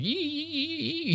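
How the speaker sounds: high, soft squeaky noises, mocking the comedian; mock squeals. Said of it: "It's like".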